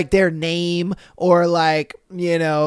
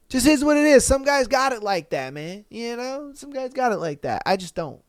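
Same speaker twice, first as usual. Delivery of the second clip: ordinary talk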